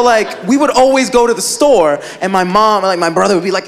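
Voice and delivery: ordinary talk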